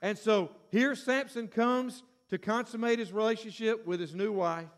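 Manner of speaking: usual speaking voice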